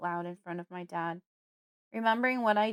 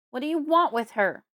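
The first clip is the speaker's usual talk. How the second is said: loud, higher-pitched angry demand; yelling; 5 semitones higher, 10 dB louder than usual. A narrator's reading. Father yelling at Fred to protect his daughter. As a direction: yell it in alarm. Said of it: "he yells".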